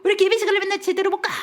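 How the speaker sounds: fast, high-pitched mock nagging; impatient complaint. Husband late for worship scolding wife, fast high nagging. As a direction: rattle off a husband's exasperated scolding. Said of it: "나는 바빠 죽겠는데 아내가 막 꼼질거려".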